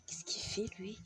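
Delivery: chuchote vers le chat, sans voix; chuchoté. Real, not acted. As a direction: murmure à peine audible. Remarque chuchotée sur son chat. (chuchotement surpris)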